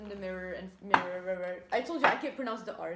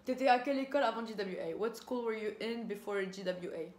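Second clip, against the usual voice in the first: ordinary talk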